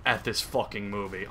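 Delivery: ordinary talk, nothing marked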